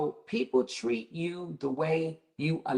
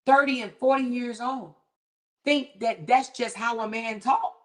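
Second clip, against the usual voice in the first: ordinary talk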